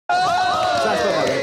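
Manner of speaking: high, slow, shouted protest; mock outrage. Real, not acted. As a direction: yell the correction in fake outrage.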